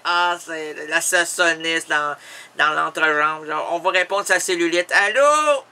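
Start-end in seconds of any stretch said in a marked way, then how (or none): 4.9-5.6 high, loud, drawn-out hello; loud phone hello. Acted. Answering the phone, calling out. (allô crié au téléphone)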